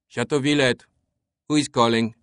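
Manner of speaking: put-on French-accented servant, irritated. A narrator's reading. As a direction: answer curtly in a French accent. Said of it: "an irritated French accent spoke".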